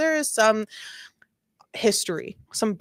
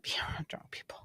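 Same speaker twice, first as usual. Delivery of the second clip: whisper; 17 dB quieter, faster than usual. Real, not acted. Aside about hating drunk parties.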